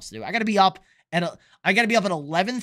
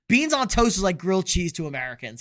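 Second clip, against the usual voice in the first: ordinary talk, nothing marked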